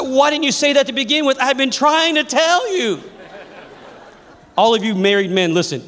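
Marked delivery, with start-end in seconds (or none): 0.0-3.0 exasperated father. Retold or imitated.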